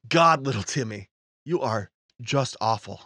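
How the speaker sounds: normal talking voice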